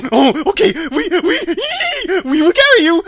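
loud, high monkey chatter with laughs; hammed-up giggling monkey voice; winged monkey king. A narrator's reading. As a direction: stammer and screech between cackles. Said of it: "replied the king".

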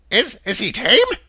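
stammering, wary woman's voice; nervous woman. A narrator's reading. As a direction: hesitate like a worried housewife.

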